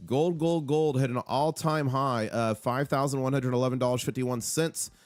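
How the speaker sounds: ordinary talk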